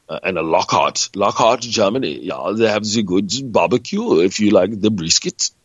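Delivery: put-on, clumsy German accent; bad German accent. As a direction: do a not-so-good German accent. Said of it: "is that the worst German accent".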